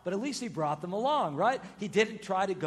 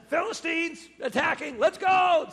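higher, louder call to arms; urgent messenger shout; 9 semitones higher, 8 dB louder than usual. Acted. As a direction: bark it like a messenger with bad news.

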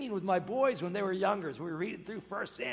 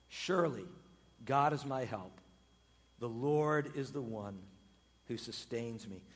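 solemn psalm reading; 5 semitones lower than usual. Acted.

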